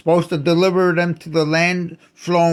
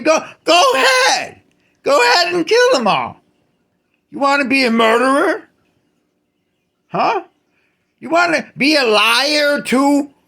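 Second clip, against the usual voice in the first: much higher, loud taunting yell; furious shout; 8 semitones higher, 3 dB louder than usual. Real, not acted. Ranter shouts angrily at Jehovah, daring him to kill. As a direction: challenge him at full shout.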